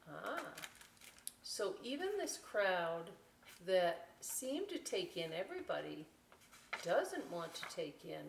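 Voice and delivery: ordinary delivery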